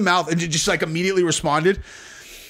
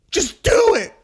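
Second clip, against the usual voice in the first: high, hammed-up imitation of her; melodramatic dare; 4 dB louder, slower than usual. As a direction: mock-desperate plea, pitched way up. Imitates her daring him.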